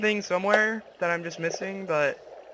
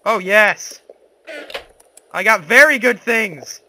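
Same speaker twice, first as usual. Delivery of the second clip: excited; 10 dB louder, slower than usual. Real, not acted. Excited loot find.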